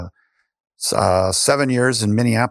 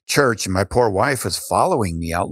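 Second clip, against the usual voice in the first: ordinary talk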